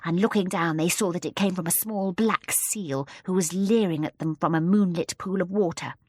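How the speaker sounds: ordinary delivery, nothing marked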